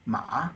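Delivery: rising "ma"; second tone. Shown as a demonstration. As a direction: lift "ma" upward like a question. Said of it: "the second tone from here to here".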